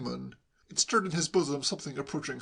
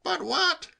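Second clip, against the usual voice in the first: high-pitched pestering question; insistent nephew; 4 dB louder than usual. A narrator's reading. Nephew pestering his stranded uncle, insistent. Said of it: "persisted Hugo insatiably".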